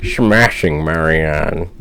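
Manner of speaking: slow, drawn-out cartoon quote; Wild Thornberrys catchphrase. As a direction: stretch out the catchphrase like a cartoon character. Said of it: "the wild thornberries say".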